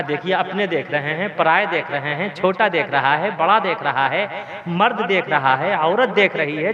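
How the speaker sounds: low, singsong list with rising ends; lightly acted hushed entreaty; pleading wife. Wife pleading with drunk husband.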